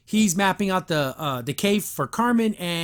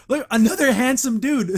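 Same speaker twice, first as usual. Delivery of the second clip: high, loud gushing; excited; 7 semitones higher, 5 dB louder than usual. Real, not acted. Viewer spotting another handsome character, excited. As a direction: burst out high and loud with delight.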